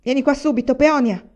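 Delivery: loud, high, commanding summons; calling out. A narrator's reading. Father orders Peonia to come, calling loudly. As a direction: call her over at once. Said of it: "suo padre era che chiamava".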